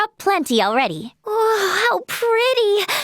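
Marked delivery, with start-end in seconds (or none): 1.2-2.9 slow, higher-pitched wonder; awed delight. Acted. Marvelling at something pretty, awed delight. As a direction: marvel at it, drawing the words out.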